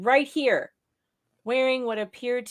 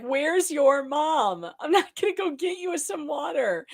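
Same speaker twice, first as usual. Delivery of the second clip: slower exasperated repeat; 6 semitones higher than usual. Retold or imitated. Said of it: "Again, like".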